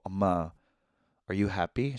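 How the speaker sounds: quiet tender question to mom; soft gentle question. Retold or imitated. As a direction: ask her softly, tenderly. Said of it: "I go".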